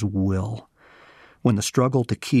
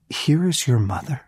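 higher, amazed questioning echo; incredulous; 4 semitones higher than usual. Retold or imitated. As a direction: repeat it in sheer disbelief, lifting at the end. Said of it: "you have got to be kidding me".

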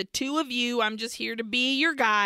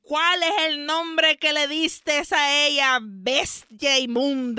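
loud raised demand in Spanish, mildly shouted; screaming; 5 semitones higher, 5 dB louder than usual. Retold or imitated. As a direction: scream the exorcist's question. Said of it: "Gregoria screams at him".

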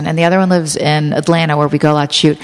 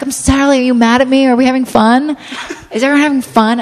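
imitating Sally; 6 semitones higher than usual. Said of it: "she's like".

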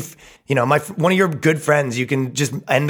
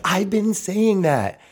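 higher, slow drawn-out vindication; mock smug agreement; 7 semitones higher, much slower than usual. Comic imitating women reading Huffington Post, mock smug agreement. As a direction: stretch it out as a mocking imitation.

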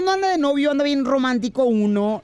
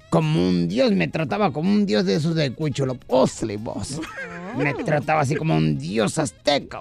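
grumbling buddy; 8 semitones lower than usual. Acted. DJ complaining to his friend about his wife, low grumble. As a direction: grumble in a deep put-on voice. Plays the friend DJ telling woes.

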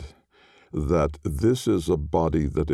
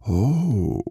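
ordinary delivery

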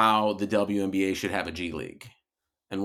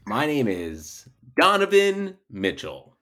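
slow, deliberate self-introduction; mock grand introduction; 3 semitones higher, slower than usual. Cohost fooling listeners with a fake name, slowly announced.

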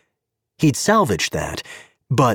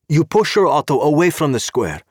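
put-on high, accented official voice; a stern Czech cop with broken English; Czech policeman; 7 semitones higher than usual. Czech cop stopping Gabe at the car, politely insistent.

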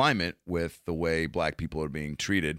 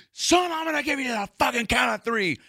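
high, cartoonish bully cop voice; mock a cocky roided-up officer; aggressive cop. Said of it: "And he goes".

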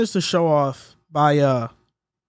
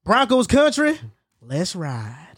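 country voice; slower than usual. Shown as a demonstration. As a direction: play the catchphrase in a country accent, dropping at the end. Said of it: "I'm gonna do a country voice".

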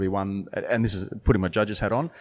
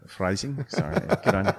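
normal talking voice

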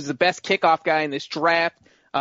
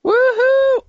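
a sudden high whoop of joy; cheering that his team goes first; mock cheer; 8 dB louder than usual. Host cheers the Bengals' turn, a high joyful whoop.

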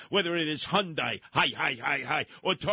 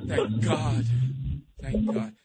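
mock relief; slower than usual.

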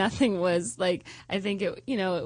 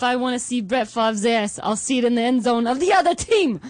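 put-on a touch of Boston accent, boastful; fan's Boston accent, a bit louder; 3 semitones higher, 4 dB louder than usual. Patriots fan mocking Brett Favre, mild Boston accent. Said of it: "Just do that accent?".

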